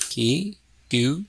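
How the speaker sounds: ordinary talk, nothing marked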